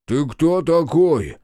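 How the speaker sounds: slow, loud, sullen question; sullen demand. A narrator's reading. Beaten policeman asking who his attacker is, sullen. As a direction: ask it heavily, drawing out each word. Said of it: "угрюмо спросил Мордатый".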